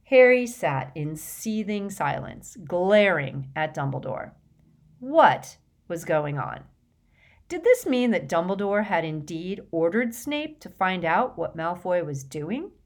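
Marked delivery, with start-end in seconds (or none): none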